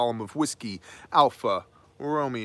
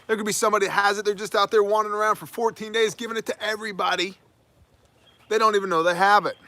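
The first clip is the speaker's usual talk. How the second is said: high-pitched, rushed scaremongering parody; mock alarm; 9 semitones higher, 5 dB louder, faster than usual. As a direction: spread the panic mockingly, high and fast. Parodying the alarm over silent spreaders.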